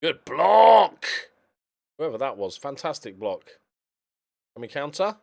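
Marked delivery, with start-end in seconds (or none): 0.0-1.1 cheering shout. Real, not acted. Cheering a defensive block.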